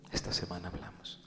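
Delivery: hushed promise, barely voiced; whispered aside. Believer quietly promising his brother a talk, whispered. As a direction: whisper it like a private confession.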